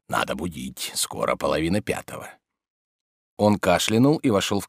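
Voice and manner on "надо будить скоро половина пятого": low, soft muttering to oneself; whispered. A narrator's reading. Servant deciding to wake his master, hushed. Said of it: "прошептал он".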